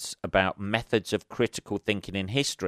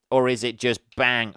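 ordinary talk

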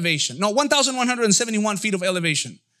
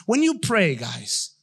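usual speaking voice

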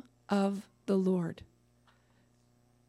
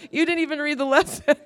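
laughing retort; 8 semitones higher, 9 dB louder, faster than usual. Retold or imitated. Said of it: "I was like".

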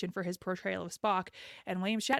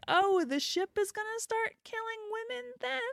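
incredulous. Retold or imitated. Incredulous quote to show.

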